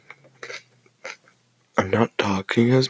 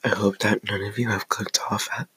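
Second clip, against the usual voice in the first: soft unvoiced ASMR whisper; 4 dB quieter than usual. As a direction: whisper it close to the mic. ASMR whispered aside to viewers.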